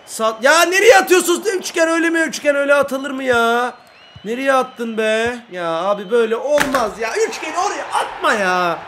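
high, loud furious rant; angry scolding. Real, not acted. Gamer berating his player Üçgen for a bad pass, furious. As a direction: tear into the player.

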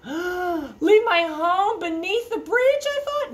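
high, slow shocked question; dismayed disbelief. Acted. Troll dreading losing his home, aghast. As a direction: gasp it out in disbelief.

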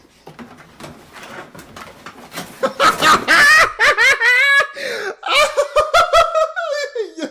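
high, loud helpless laughter, can't hold it in; loud laughing fit. Real, not acted. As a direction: burst out cackling, way up high. Bursts out laughing at clip.

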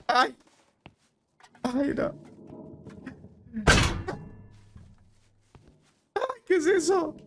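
high startled squeak. Real, not acted. Player startled by a shape, high startled squeak. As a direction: jump at something unseen.

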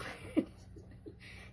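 soft, voiceless laugh; breathy giggle. Real, not acted. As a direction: giggle quietly under the breath. Laughs at own remark.

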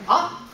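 Ah short and rising like a surprise; a quick surprised rising Ah; second tone demo. Shown as a demonstration. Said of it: "it's short, right?".

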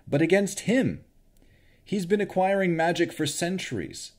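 Henry rising to high, loud despair; despairing fighter. A narrator's reading. Henry despairs about Strahd.

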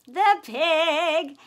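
surprised. Acted. Gasped reveal.

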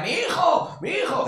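high panicked scream; mother screaming for her child. Retold or imitated. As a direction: shriek like a frantic mother. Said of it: "una señora se puso a gritar".

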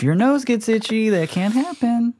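very high-pitched panicky put-on voice; mock alarm. Acting out the first sign of zombie apocalypse, mock alarm. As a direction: squeal it in hammed-up disbelief, dragging the end.

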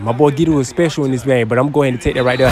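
ordinary talk

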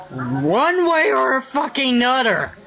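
ordinary delivery

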